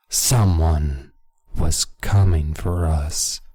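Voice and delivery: slow, loud, portentous cliffhanger; ominous narration. Acted. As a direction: draw it out like impending doom. Dramatic reading of the cliffhanger line.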